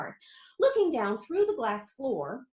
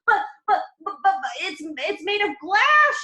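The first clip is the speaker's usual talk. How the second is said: higher, louder, stuttering; panicked stammer; 7 semitones higher, 7 dB louder than usual. A narrator's reading. Grandpa Joe panicking at Wonka, high and loud. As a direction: stammer in fright. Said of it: "shouted Grandpa Joe".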